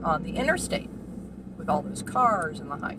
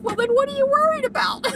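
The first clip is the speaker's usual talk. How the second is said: much higher and louder, baffled; exasperated question; 7 dB louder than usual. Retold or imitated. Asking her nervous elderly client, high and incredulous. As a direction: squeal the question in disbelief. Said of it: "And I'm like".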